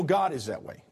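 ordinary delivery

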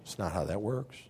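a hint of a low, dry God voice; lightly voiced God. God to Jonah, lightly voiced dry refusal. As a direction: answer like God shutting it down. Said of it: "And God's like".

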